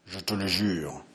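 low, hushed solemn vow; hushed oath. Acted. Henri swears to his brother, hushed and solemn. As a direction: swear it quietly, gravely.

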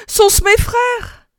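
very high, drawn-out eager question; slow, pleading high question; hopeful cry. A narrator's reading. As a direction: ask with desperate hope. Wife hopes rescuers are coming.

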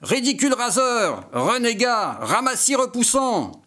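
high, far-off voice spitting disgust; revolted retort. Acted. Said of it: "Romaine réplique révulsée".

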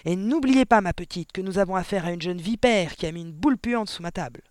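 indignant headmistress tirade; explosive anger. A narrator's reading. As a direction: hammer the accusation home. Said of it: "explosa mademoiselle Le Gourdin".

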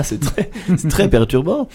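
ordinary talk

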